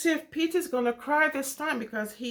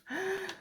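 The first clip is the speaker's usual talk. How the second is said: gasp; 6 semitones higher, 7 dB quieter than usual. Acted. Reacting to lost button.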